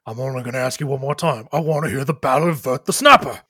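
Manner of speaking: half-orc demand building to loud; threatening half-orc. Acted. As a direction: give a last warning as the brute. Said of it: "The half-orc turns".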